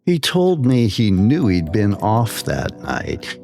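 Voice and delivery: ordinary delivery, nothing marked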